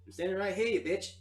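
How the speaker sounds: high, loud annoyed yelling; indignant shout. Acted. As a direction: yell back offended. GM voices revived friend Nick Dick.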